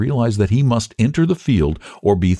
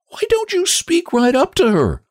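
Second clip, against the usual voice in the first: very high, sharp-tongued woman; high-pitched indignant demand; indignant prodding. A narrator's reading. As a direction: urge it in a high, exasperated pitch. Lottie indignantly prompts Sarah.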